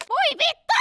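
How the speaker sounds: sudden high yelled swear; yelled curse. Real, not acted. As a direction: blurt it out in a high yell.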